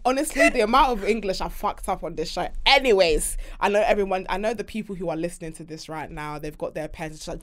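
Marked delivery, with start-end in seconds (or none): none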